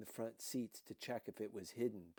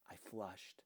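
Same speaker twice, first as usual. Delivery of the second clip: plain reading voice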